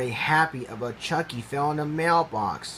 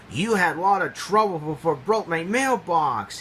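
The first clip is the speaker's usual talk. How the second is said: a touch of a cross snap; snapping; 4 semitones higher than usual. Acted. Boss scolding the impala, snapping mildly. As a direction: snap at him, mildly annoyed. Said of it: "he snapped".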